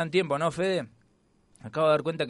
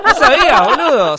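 high, loud burst of laughing disbelief; cracking up at the news; laughing surprise; 13 semitones higher, 13 dB louder than usual. Real, not acted. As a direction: laugh it out, shocked.

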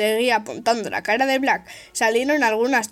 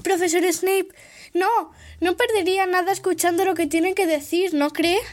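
put-on high, breathless girl pleading; timid, breathy plea; 7 semitones higher than usual. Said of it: "dijo casi sin aliento".

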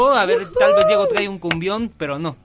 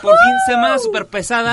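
excited; 9 dB louder than usual. Real, not acted. Relief at week's end.

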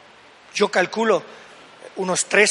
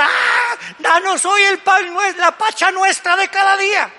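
mock baby praying; 9 semitones higher than usual. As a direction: mimic a baby reciting a prayer. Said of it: "cuando Baby llora, no está diciendo". (bebé que reza imitado, agudo y lento)

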